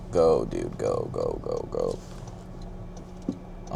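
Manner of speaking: low, growling hype-man chant; deep gym hype. Pretend. Hyping himself up for a gym set, deep growl. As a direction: pump yourself up like a gym bro.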